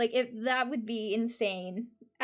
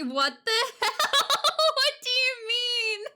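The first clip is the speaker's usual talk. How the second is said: high, loud laughing disbelief; stunned laugh; 11 semitones higher, 5 dB louder than usual. Real, not acted. Streamer seeing clip hit a hundred, high laughing disbelief. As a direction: laugh it out in shock.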